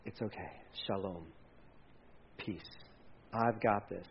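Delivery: calm a frightened man, barely above a breath; gentle reassurance. Acted. The Lord calming terrified Gideon, hushed and tender.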